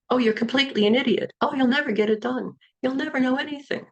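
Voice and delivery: high, quick, mocking talking-finger voice; silly little voice. Shown as a demonstration. Coach playing a negative thought spoken by a fingertip, silly little voice. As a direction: pipe up fast and high as a tiny inner critic. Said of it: "that little voice".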